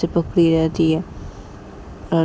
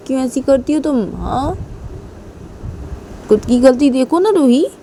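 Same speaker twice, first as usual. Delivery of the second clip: higher-pitched pleading, pressing her to admit fault; heated pleading; 6 semitones higher than usual. Acted. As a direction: plead high and insistent, demanding she see her own mistake. Husband pleads in heated quarrel.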